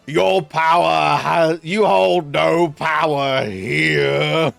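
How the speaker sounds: loud, very slow, booming proclamation; mock-grand defiance. Acting out a reply to the uni lecturer, mock-grand defiance. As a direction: draw it out like a theatrical showdown.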